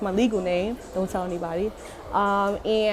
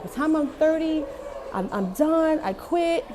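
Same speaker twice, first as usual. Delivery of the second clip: sing-song vow; 8 semitones higher than usual. Retold or imitated. Retold carefree resolution, sing-song.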